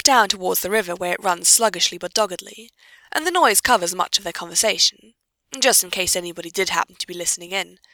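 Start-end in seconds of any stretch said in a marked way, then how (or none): none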